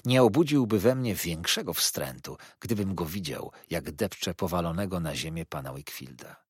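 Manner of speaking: usual speaking voice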